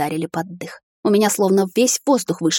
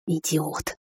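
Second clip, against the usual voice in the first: much softer hiss through clenched teeth; 3 semitones lower, 8 dB quieter than usual. A narrator's reading. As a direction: spit it out quietly through teeth. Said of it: "зашипела я сквозь зубы".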